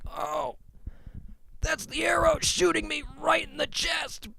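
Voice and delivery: high, hammed-up wail of pain; mock anguish. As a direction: wail like shot with an arrow. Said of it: "let's do some audio for the audio listeners".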